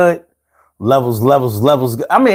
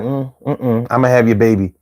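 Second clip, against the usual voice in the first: voicing the girlfriend; 7 semitones lower than usual. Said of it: "She was like".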